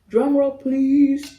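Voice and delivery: slow loud announcer drawl; drum roll announcement. Acted. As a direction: drag it out like a game show host. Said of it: "that's not how a drum roll sounds".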